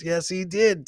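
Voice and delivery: ordinary talk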